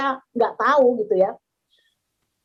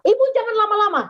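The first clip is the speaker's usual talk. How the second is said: higher, impatient mock threat; angry caller; 7 semitones higher, faster than usual. Scam caller angrily telling the mother to hurry. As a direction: press her with a lightly angry threat. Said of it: "Dia marah saya".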